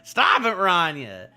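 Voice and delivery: loud, high exclamation of reproach; mock scolding. Reader breaks in on Rania's apology, loud mock scolding. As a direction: cut in loudly to stop her.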